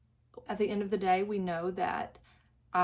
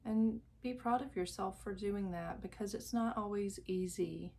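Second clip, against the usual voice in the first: guided meditation; 6 dB quieter than usual. Acted. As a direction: lull the listener into calm, drawing out each phrase. Leads a guided visualization.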